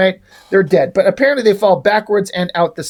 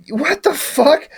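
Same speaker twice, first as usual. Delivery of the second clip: slow, high-pitched incredulity; real exasperated bewilderment; baffled disbelief; 8 semitones higher, slower than usual. Real, not acted. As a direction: draw it out, totally baffled. Baffled reaction to pizza throwing.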